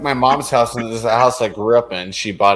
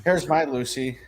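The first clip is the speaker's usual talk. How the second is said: ordinary talk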